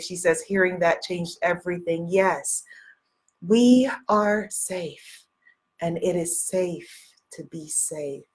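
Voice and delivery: normal talking voice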